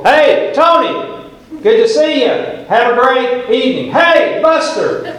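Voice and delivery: high, bright calling out to passing folks; cheery greetings. Retold or imitated. Pastor calling hellos to church members walking out, high and cheery. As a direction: hail each person you pass.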